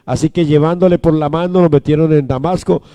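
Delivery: usual speaking voice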